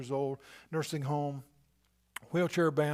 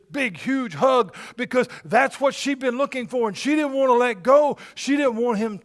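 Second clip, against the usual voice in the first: louder and higher, building to a cry; building to a shout; 7 semitones higher, 9 dB louder than usual. Real, not acted. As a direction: lift it up to full preaching force. Preacher building excitement.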